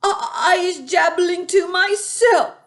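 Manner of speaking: high, loud stammering fib; nervous giant. A narrator's reading. BFG nervously lies to the Bloodbottler. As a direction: stammer out the lie.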